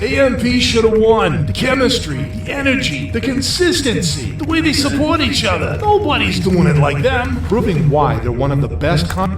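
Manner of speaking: put-on New York accent, pitched high. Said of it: "That's supposed to be a New York accent?".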